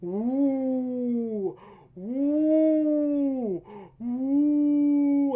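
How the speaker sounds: mocking hallway crowd. Students in hallway jeering at boys with cops, drawn-out mocking ooh. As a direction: tease like kids watching someone get busted. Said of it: "dumbasses who were sitting there like".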